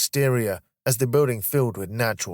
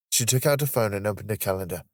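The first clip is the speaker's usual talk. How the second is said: ordinary delivery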